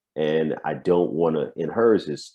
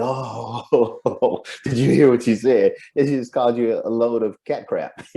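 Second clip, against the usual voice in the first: excited gossiping onlookers; 6 semitones higher than usual. Retold or imitated. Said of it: "they'll be like".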